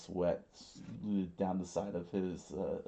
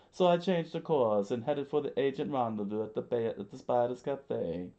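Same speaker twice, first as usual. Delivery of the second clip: high, louder voice, hurried; quick high-voiced henchman; underling character explaining; 5 semitones higher, 5 dB louder than usual. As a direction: rattle off the excuse pitched up. Voices Mister Three explaining.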